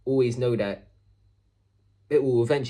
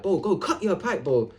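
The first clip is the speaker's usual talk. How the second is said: telling loudly; 6 semitones higher than usual. Retold or imitated. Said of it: "I just kept telling him loudly".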